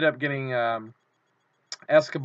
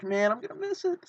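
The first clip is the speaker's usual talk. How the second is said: anxious whine; 11 semitones higher than usual. Retold or imitated. Fears missing the autograph.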